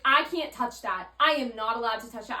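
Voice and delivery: loud, emphatic, imitated refusal; mock officious refusal. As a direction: play the rule-bound agent refusing. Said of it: "She's like".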